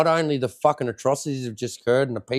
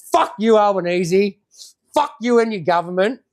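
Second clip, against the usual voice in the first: angry outburst; 4 semitones higher, 4 dB louder than usual. Real, not acted. Closing insult at the prime minister.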